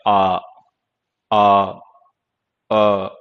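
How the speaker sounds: teacher demonstrating the vowel; open-mouth vowel. Shown as a demonstration. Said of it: "open mouth से produce होने वाले हैं".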